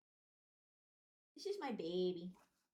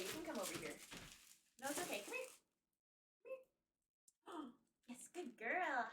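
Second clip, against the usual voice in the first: ordinary delivery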